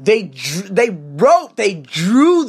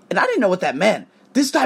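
ordinary talk, nothing marked

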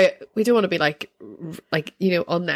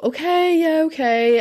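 higher-pitched, placating okays; placating agreement; 9 semitones higher than usual. Retold or imitated. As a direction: humour someone you want to get away from. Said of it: "I kept trying to be like".

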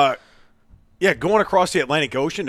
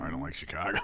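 ordinary delivery

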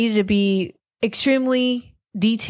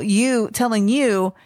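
ordinary delivery

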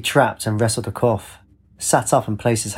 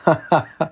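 short high cackle; cackled laugh; 6 semitones higher than usual. A narrator's reading. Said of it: "cackled a laugh".

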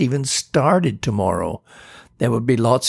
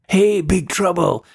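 calling out; 7 semitones higher than usual. Pretend. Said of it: "calling me across the room".

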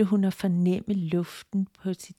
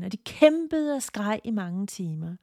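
ordinary delivery, nothing marked

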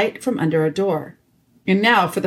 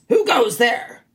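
lightly snarled sentry challenge, pitched up; snarling badgers; 6 semitones higher, slower than usual. A narrator's reading. Two sentinel badgers challenge strangers at the archway, snarling. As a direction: challenge intruders with a touch of snarl. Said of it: "asked them in snarling voices".

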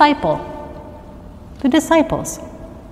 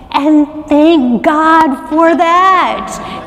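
a big, drawn-out cry of thanks to heaven; joyful exclamation; 6 semitones higher, 3 dB louder, much slower than usual. Real, not acted. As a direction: shout it out high and slow, full of relief.

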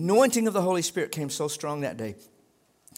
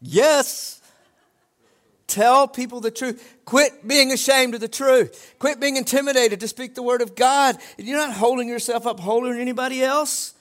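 forceful preaching; 7 semitones higher, 6 dB louder than usual. Real, not acted. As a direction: drive each command home to the congregation.

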